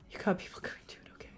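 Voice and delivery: unvoiced whisper. Real, not acted. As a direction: breathe it as a secret. Whispers aside.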